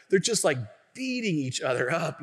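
normal talking voice

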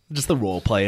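ordinary talk

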